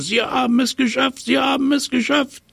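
high, shrieking with joy; excited monkey shout. Acted. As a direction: cheer it like a wild little monkey. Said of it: "schaukelte wild hin und her und schnatterte".

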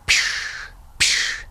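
breathy hissing psch of flames; fire hiss. Acted. Narrator hisses the dragon heads spitting fire over the bed.

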